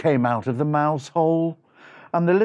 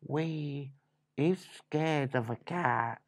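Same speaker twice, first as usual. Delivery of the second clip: softer, slow confession; hushed admission; 3 semitones lower, 9 dB quieter, slower than usual. Acted. Mouse admitting to his brother they fear cats, hushed. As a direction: let it out slowly and quietly. Sheepish admission about the cat.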